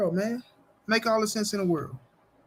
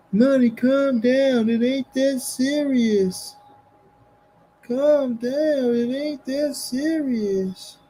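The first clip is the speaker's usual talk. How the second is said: higher, louder, slow mock soothing voice; mocking calm down; 5 semitones higher, 3 dB louder, slower than usual. Mocking the chat that told him to calm down, slow and high. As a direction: drag it out like a patronizing chatter. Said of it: "they tell me calm down".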